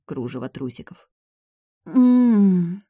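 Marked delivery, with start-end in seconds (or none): 1.9-2.8 loud high moan. A narrator's reading. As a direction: moan into the kiss. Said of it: "застонала высшая".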